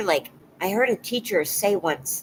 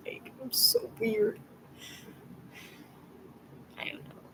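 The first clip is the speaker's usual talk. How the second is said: whisper; 5 semitones higher, 12 dB quieter than usual. Real, not acted. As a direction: breathe it out, embarrassed. Shy self-doubt to viewers.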